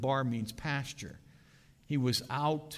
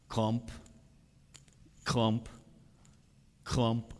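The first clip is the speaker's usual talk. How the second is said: crutch clumps; much slower than usual. Retold or imitated. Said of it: "you hear".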